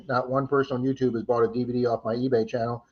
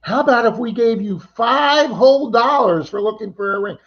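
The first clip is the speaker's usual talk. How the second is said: high, put-on voice of a tipsy lady; 8 semitones higher, 5 dB louder than usual. Retelling the ladies' lowball offer in a tipsy lady's voice. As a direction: mimic the tipsy woman asking, higher. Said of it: "She goes".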